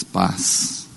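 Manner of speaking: low, breathless whisper; whispered king's farewell. Retold or imitated. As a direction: breathe it like a calm king. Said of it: "ele diz". (sussurro baixo de rei)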